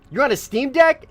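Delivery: very high, loud surprised question. Real, not acted. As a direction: squeal it in disbelief. Reacting to chat message.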